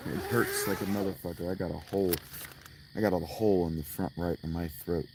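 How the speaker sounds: normal talking voice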